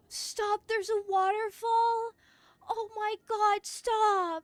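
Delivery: excited exclamation. Real, not acted. Gamer crying out at a waterfall on screen. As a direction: shout in awe at the waterfall.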